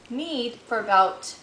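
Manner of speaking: ordinary talk, nothing marked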